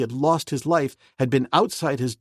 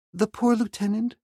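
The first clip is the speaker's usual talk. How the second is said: put-on higher young woman's voice, anxious; Jane Porter; 10 semitones higher than usual. Jane Porter asking Clayton about the lieutenant, high and anxious. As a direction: voice a worried young lady, pitched up.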